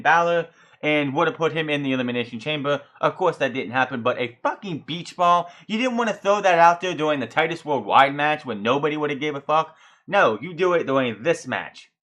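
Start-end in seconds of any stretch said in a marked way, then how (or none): none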